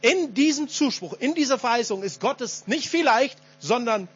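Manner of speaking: höher, mit Nachdruck verkündet; kraftvolles Predigen. Real, not acted. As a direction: predige es mit voller Überzeugung, Schlüsselwörter herausgehoben. Preaches at full force. (leidenschaftliche Glaubensproklamation)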